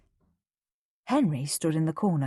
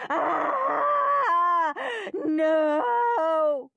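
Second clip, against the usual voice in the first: high crying scream; terrible screams; 13 semitones higher than usual. A narrator's reading. Susan getting her injection, screaming and crying. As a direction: cry out in pain and protest. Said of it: "came the terrible screams".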